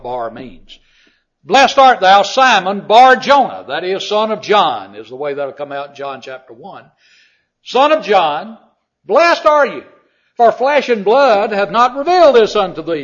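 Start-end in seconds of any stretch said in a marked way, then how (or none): 1.4-13.0 full-force preaching. Acted. Preacher proclaims Peter's confession to the congregation, shouting. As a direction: declare it like Peter's great confession. Preacher voicing Jesus' blessing.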